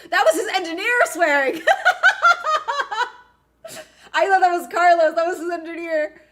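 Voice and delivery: high, loud, laughing; laughing disbelief. Real, not acted. Streamer hearing the engineer swear on radio, laughing loudly. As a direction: laugh it out in shock.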